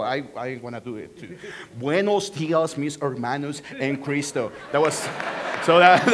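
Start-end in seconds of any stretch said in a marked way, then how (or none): 1.8-4.5 put-on Spanish with a thick American accent. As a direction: imitate an American mangling the Spanish greeting. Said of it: "American accent in Spanish".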